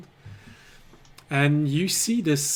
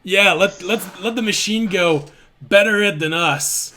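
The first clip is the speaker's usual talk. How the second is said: higher, louder rogue urging; cowardly rogue; 6 semitones higher, 6 dB louder than usual. Acted. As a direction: push the machine forward to save yourself.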